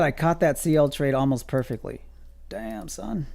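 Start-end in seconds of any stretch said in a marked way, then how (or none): 2.5-3.3 nineties rapper. Pretend. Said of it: "As I talk like a nineties rapper".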